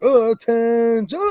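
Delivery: slow, high, stretched-out military call; mock parade-ground order; boot camp command. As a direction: draw it out like a drill instructor. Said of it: "a boot camp scene or something".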